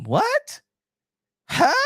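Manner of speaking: squeaked high in disbelief; stunned what. Real, not acted. Vlogger reacting to a viewer's claim with a high incredulous squeak. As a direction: jump way up, can't believe it.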